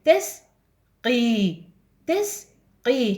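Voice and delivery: تس and قي pulled apart very slowly; teacher sounding out the word; slow syllabified. Shown as a demonstration. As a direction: spell تسقي out slowly. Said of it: "يصير عندنا تس".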